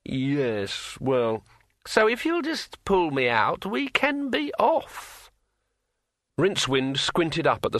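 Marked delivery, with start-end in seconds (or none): 0.0-5.3 high, slow, eerie ringing voice; like a finger round a wine glass rim; ringing metallic sword voice. A narrator's reading. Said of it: "in a voice like the sound of a finger dragged".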